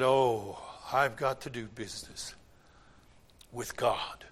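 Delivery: low, soft sober realization; quiet resolve. Retold or imitated. Convicted driver deciding to face God, hushed and low. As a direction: sink the voice, as if thinking aloud.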